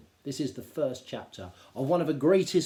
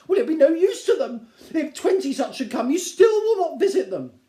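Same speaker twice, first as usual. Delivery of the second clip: much higher and louder, whining; exasperated Mrs Bennet; 14 semitones higher, 12 dB louder than usual. Acted. Wife complaining to her tiresome husband, high and whining. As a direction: scold him shrilly.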